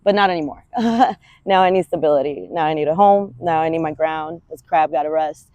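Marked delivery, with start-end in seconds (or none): none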